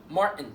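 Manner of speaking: "مارتن" as "Mar'n", glottal stop for T; hiccup T. Shown as a demonstration. Teacher giving an example name, hiccup T. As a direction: catch the throat in place of T in "مارتن". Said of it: "بتنطق زي الهمزه كده".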